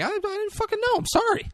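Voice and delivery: high-pitched mock pleading; acted, high-voiced apology; imitating sincere apology. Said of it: "Cranston's genuine".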